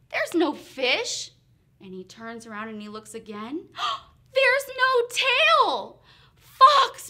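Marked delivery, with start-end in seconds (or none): 0.1-1.3 gasp, then higher, louder, drawn-out dismay; shocked gasp. Acted. Bear finding no fish, gasping in surprise. 3.7-6.0 big gasp, then high, loud, slow wail; horrified gasping cry. Acted. Bear discovering his lost tail, crying out shocked. 6.4-7.1 high, very loud call; outraged shout. Acted. Bear confronting the trickster Fox, shouting in outrage.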